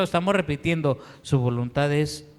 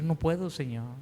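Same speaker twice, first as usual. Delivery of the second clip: much softer, giving up; broken quiet admission; 8 dB quieter than usual. Retold or imitated. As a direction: let it break off softly, defeated. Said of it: "postrarme y llorar y decir". (confesión quebrada en voz baja)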